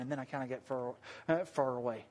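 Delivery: slipping into a Southern accent; soft, low Southern slip on "far". Real, not acted. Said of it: "my southern accent coming out".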